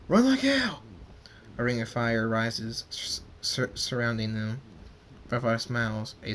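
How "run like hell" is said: panicked shout. Acted. General panics and flees.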